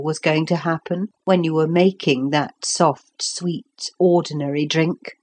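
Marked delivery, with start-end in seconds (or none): none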